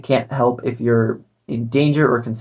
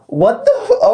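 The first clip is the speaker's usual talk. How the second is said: shocked. Real, not acted. Shocked by the bot's reply.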